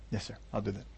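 low, hushed, submissive reply; meek agreement. Acted. Believer agreeing to do as God says, meekly. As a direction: give in very quietly.

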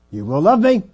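loud demand climbing high; demanding shout. Acted. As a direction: cry it out as an order. Preacher acts out Leah's demand.

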